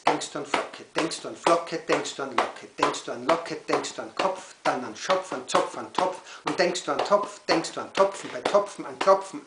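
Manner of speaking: loud, driving chant-like beat; rhythmic poem recital. Acted. Poet fires rhyming poem at children, rhythmic recital. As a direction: punch each rhyme word, then break off into pauses.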